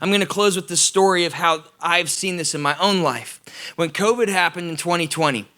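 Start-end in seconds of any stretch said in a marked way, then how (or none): none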